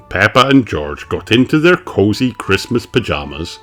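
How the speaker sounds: ordinary delivery, nothing marked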